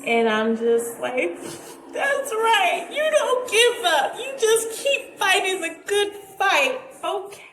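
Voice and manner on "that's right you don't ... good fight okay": fake cheerleading. Mock-agreeing with fake mom.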